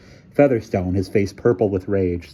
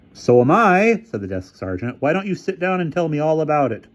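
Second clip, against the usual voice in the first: character voice; 8 semitones higher, 3 dB louder than usual. A narrator's reading. Desk sergeant.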